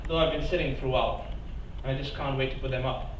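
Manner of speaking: usual speaking voice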